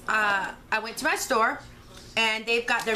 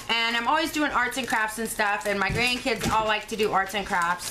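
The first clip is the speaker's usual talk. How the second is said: usual speaking voice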